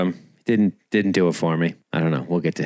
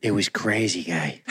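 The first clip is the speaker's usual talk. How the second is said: softer mock Boston bro; bad Boston accent; 4 semitones lower, 6 dB quieter than usual. Boston guy confiding in his pal, bad Boston accent. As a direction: trail down in hammed-up Boston. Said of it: "he's got a shitty Boston accent now".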